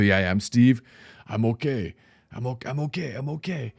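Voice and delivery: put-on Steve reassuring them in a Miami mafioso accent. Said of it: "mafioso Miami accent".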